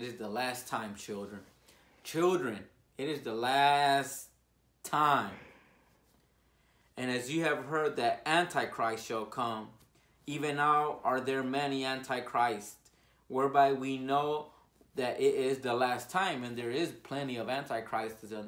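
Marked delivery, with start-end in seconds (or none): 2.0-5.3 put-on a slow loud warning to the children; preaching. 7.0-16.4 louder, ringing scripture reading; loud fervent scripture reading; preaching scripture. Acted.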